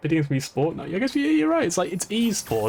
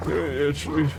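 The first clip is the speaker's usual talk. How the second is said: slurred, rambling grandpa impression; Sean Connery. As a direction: imitate grandpa with mushy sh sounds. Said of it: "he sounds like Sean Connery".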